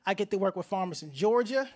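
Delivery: usual speaking voice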